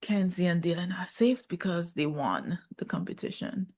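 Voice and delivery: unvoiced, secretive whisper. Recapping the show to viewers, in a hushed whisper. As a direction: breathe it like a juicy secret. Revealing who is safe.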